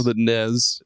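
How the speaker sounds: "nes" said as one word ending in a buzzing Z. Shown as a demonstration. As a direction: say "nes" to rhyme with "fez". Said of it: "YouTube saying nes".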